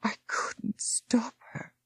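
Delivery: slow, whispered and strained; with difficulty. Acted. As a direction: force the words out though weak. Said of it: "Patricia explained with difficulty".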